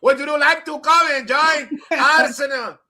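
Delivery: loud, slow yelled invitation; mock-yelling phone call to a player. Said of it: "Yo, why you yelling".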